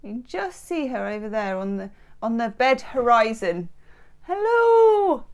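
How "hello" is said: high-pitched hello. Acted. Greeting the cat or viewer playfully.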